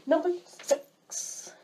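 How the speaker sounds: high, slow, stretched-out countdown call; drawn-out announcement. Shown as a demonstration. Vlogger announcing the next pet peeve, drawn-out announcement. Announces item, then redoes it.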